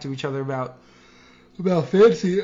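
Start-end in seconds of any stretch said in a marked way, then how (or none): 1.6-2.4 words stretched by a yawn. Real, not acted. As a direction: yawn through it. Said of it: "I'm so sorry that I'm yawning right now".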